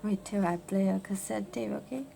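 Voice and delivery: normal talking voice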